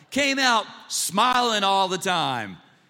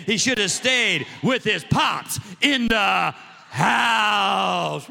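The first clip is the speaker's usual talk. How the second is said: T D Jakes preaching; 4 dB louder, much slower than usual. Retold or imitated. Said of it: "when the bishop does that sermon, it's kinda like".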